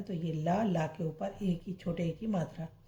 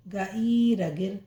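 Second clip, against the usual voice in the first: slow, separated syllables joined into जिन; teacher sounding out जा ई न, then जिन; slow syllable blending; 4 dB louder, slower than usual. Shown as a demonstration. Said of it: "गाफ की half शक्ल रे जेर गिर". (धीरे-धीरे अक्षर जोड़कर पढ़ाना)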